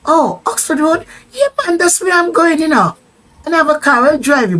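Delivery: acted eager offer. Rasta man offers a lift to Oxford Road, acted high eagerness. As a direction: jump in high and keen, imitating him.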